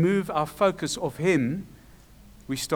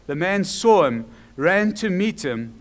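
ordinary talk, nothing marked

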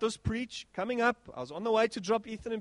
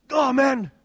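louder frustrated "Oh, man"; cross exclamation; 4 semitones higher, 8 dB louder than usual. Retold or imitated. Preacher retells crash, louder frustrated outburst. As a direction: vent at the crashed software. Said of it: "I was cross".

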